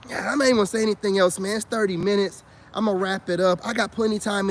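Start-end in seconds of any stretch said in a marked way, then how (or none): none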